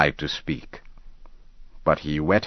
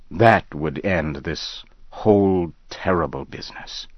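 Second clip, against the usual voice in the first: ordinary delivery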